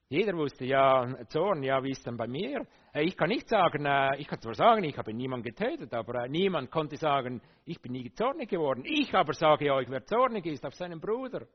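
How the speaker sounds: loud, driving sermon voice; full-force pulpit delivery; fiery preaching. Acted. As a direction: preach it hard to the congregation.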